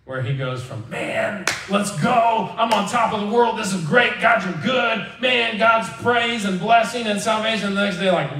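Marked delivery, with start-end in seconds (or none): 0.9-7.8 voiced Jonah, up high and buoyant with excitement; mock elated Jonah. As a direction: play Jonah riding a spiritual high.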